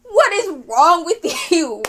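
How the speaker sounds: real exasperation breaking into a laugh; frustrated outburst. Real, not acted. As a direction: scold them in helpless disbelief. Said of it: "I'm tearing up from frustration".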